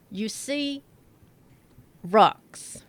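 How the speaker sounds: ordinary delivery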